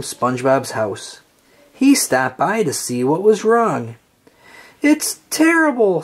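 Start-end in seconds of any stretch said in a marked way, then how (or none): 4.8-6.0 high, distraught wail; lightly played misery; crying out. A narrator's reading. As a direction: cry out in despair, much higher. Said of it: "SpongeBob cried".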